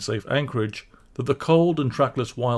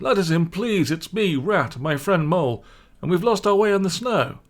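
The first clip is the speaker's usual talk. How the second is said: higher, urgent plea; pleading; 8 semitones higher, 3 dB louder than usual. Acted. Rat begs Badger to let them in, pleading. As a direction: beg to be let in from the cold. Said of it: "cried the rat".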